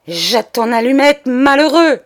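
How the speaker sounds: loud, alarmed command; ordre crié. Acted. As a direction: shout at the boy to drop it. Cousin panics over the match. (ordre affolé, crié fort)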